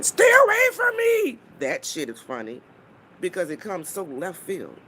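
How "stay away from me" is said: high, loud mock shriek of panic; mocking scream. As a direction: scream it like someone losing it, hammed up. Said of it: "you started screaming at the top of your lungs".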